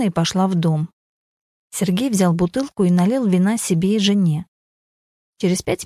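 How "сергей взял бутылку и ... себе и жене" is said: ordinary delivery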